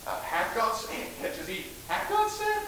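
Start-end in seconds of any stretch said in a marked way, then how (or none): 0.2-1.1 hushed, slow devil's insinuation; sly devil. Acted. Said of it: "here comes the devil". 1.9-2.6 high, soft tempting question; sly devil. Acted. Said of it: "here comes the devil".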